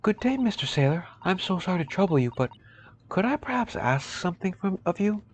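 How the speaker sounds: high, sweet, over-courteous voice; butter him up in a high polite voice; a high, fawning gentleman's voice; overly polite young man. Acted. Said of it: "let me try instead".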